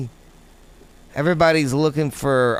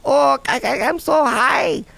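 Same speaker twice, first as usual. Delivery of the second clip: high-pitched, hammed-up Chinese accent; bad Chinese impression; 10 semitones higher than usual. Host imagining someone high in China, bad Chinese impression. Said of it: "that was the worst Chinese impression".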